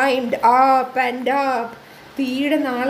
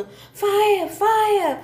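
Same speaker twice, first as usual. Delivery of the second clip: crying fire; 6 semitones higher than usual. Acted. Animals crying out about the fire, raised alarm. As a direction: raise the alarm across the forest. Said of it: "heard the cry of birds".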